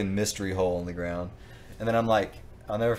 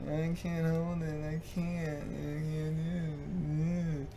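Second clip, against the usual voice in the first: high, slow whiny I can't; imitating a drunk about to throw up; mock desperate whimper, pitched up; desperate drunk plea; 8 semitones higher, 5 dB quieter, slower than usual. Retold self about to vomit.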